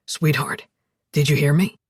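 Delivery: low, steady man's voice; calm her in a low voice; deep, steady reassurance; reassuring Alex. A narrator's reading. Alex soothes crying Zoe on phone.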